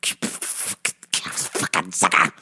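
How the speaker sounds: very soft, slow, barely voiced cursing; seething under breath. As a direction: curse him almost silently. Seething under breath while watching.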